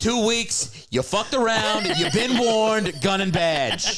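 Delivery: high, loud hammed-up cop rant, slowing on "you've been warned"; mock tough-cop yell. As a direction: bark it like a movie cop firing someone. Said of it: "I don't even know what accent I'm doing".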